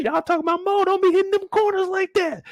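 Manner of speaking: high wailing backseat cry; shrieking high mock complaint from the back seat; mock passengers crying out. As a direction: whine it out like a scared passenger.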